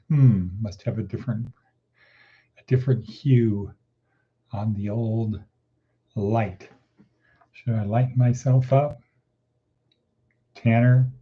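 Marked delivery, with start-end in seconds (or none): none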